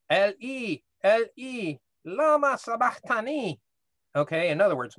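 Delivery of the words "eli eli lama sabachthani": raised pitch, imitating a cry from the cross; retold loud cry. Teacher quoting Jesus crying out on the cross, mild retold cry. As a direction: call it out higher, without real shouting. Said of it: "cried out in a loud voice".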